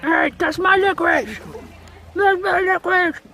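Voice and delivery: playful indignant protest; mock outrage. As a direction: pretend he stole your sweets. Playful protest over stolen licorice.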